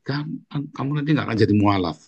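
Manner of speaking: usual speaking voice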